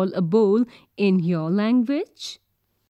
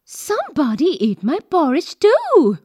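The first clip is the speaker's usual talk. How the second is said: lightly voiced mother bear, higher pitch; Mama Bear; 5 semitones higher, 4 dB louder than usual. A narrator's reading. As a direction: chime in as the upset mother bear. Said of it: "said Mama Bear".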